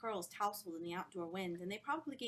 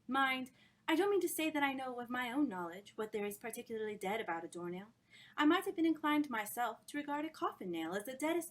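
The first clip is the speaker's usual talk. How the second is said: louder, relished dramatic recitation; reciting; 3 semitones higher, 5 dB louder than usual. Acted. Woman reciting Dickens to the group, dramatic. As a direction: recite it eyes closed, savouring it. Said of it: "began to recite".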